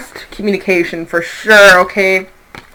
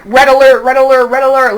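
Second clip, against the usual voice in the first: loud, urgent, like a siren going off; alarm call; 4 semitones higher, 5 dB louder than usual. Pretend. Intuition warning Scorpio, urgent loud alarm. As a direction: sound the alarm.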